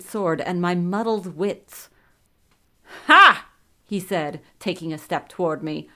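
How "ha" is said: one loud, high scornful "Ha!"; drwiący śmiech. A narrator's reading. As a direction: bark out a triumphant villain's laugh. Villain laughs in triumph.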